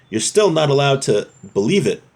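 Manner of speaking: ordinary talk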